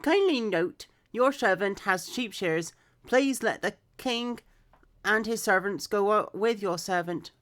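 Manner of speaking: clear, projected story reading; scripture read-aloud. Acted. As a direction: read the Bible story out with expression.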